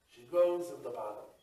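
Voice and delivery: ordinary delivery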